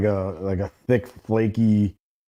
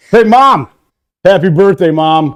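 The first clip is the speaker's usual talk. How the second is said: high, loud call to Mom; big, loud birthday greeting; birthday shout-out; 6 semitones higher, 11 dB louder, slower than usual. Real, not acted. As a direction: holler it out to Mom on air. Birthday shout-out to his mother.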